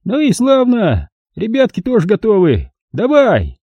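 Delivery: put-on high, cheerful cruel old man. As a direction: play a folksy sadistic old prospector. Old man gives the signal.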